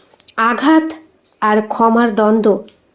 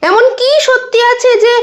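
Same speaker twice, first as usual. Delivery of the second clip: startled question, pitch jumping high; high-pitched, taken-aback questioning; 10 semitones higher than usual. A narrator's reading. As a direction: react with sudden disbelief. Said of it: "Sumit চমকে ওঠে".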